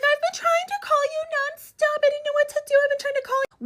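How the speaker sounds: hammed-up panicked friend voice; mock freaking-out friend. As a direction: squeal it like she's panicking in the driveway. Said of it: "she's freaking out".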